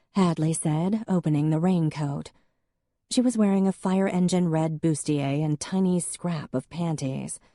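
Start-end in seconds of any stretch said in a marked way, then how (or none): none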